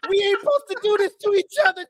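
put-on high, pleading kid voice; a hint of a hurt child; mock plea. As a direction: beg like a betrayed kid. Mock plea after laugh.

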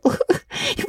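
put-on breathless girlish giggle; high gasping laugh. A narrator's reading. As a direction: burst into helpless laughter. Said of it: "gasping with laughter".

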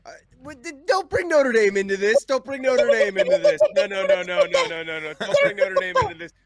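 high, loud mock outrage; outraged shout. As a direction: yell it like your team was insulted. Mock outrage over Notre Dame jab.

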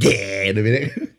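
lightly voiced big cat yeah. Shown as a demonstration. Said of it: "I want to do a big cat voice".

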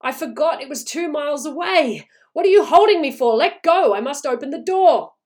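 high, loud, struggling to get free; frantic demand. A narrator's reading. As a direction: shout in desperate urgency. Wife struggles to reach son.

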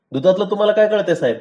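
fast, high-pitched sneer; taunting. A narrator's reading. Milkman sneering at the gentleman, quick taunt. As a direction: belittle him, quick and cutting. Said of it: "पांडानं माधवला हिणवलं".

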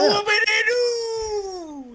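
very high, slow, drawn-out shout of mock joy; mock cheer. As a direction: cheer it way up and stretch it out. Mock cheer at station news.

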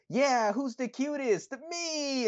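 high squealed cutesy boast; high mock impression of Maki boasting cutely; imitating Maki. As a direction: squeal it like a cute wrestler. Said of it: "when she's like".

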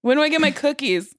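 ordinary talk

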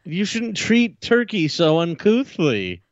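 slow, prim disapproving voice; prissy scolding. Acted. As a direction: scold like a fussy aunt.

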